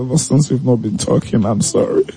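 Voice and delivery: high, teary begging woman's voice; lady apologising to Kofi, high and pleading; pleading girlfriend. Retold or imitated. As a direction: beg him for forgiveness in a high, pleading voice. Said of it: "she's gonna go like".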